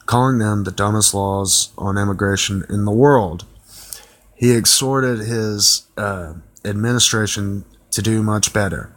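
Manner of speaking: normal talking voice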